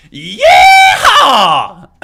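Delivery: loud, high cowboy whoop; yeehaw. Real, not acted. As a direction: holler it like a rodeo cowboy. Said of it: "give me a good old Deckmar Trades".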